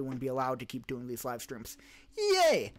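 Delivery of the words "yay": high ironic cheer; a sarcastic high cheer; mock cheer, high; mock yay. Mock cheer on tanked internet.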